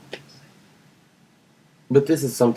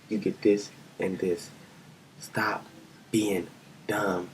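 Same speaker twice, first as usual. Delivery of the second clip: hushed scolding; 4 semitones lower, 8 dB quieter, slower than usual. Acted. Repeating the scolding of the governor.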